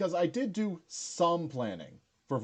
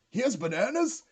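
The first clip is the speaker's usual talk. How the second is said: high, disbelieving mock question; a hammed-up gasp of a question, much higher; imitating Yang; 11 semitones higher, 5 dB louder than usual. Retelling Yang's reaction to bananas, high mock surprise. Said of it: "Yang's just like".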